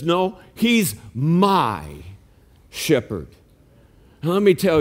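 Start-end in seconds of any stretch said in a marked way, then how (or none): 0.0-3.3 slow "No, he's MY shepherd", my leaned on; stress on my. Shown as a demonstration. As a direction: drag out "my" before shepherd. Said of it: "It says".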